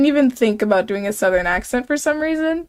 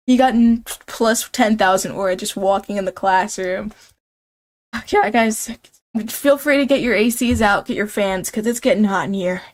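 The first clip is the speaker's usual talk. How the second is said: ordinary talk, nothing marked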